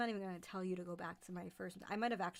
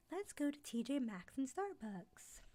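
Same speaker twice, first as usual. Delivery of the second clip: higher-pitched playful put-on voice; perky mock suggestion; 6 semitones higher than usual. Mimicking an eager shopper's outing plan, high perky put-on voice.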